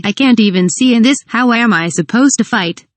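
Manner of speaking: ordinary delivery, nothing marked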